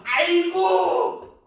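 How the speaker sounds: huge high drawn-out yell; mock cry of pain. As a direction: howl it long, as if suddenly caught. Acted yell as arm is pulled.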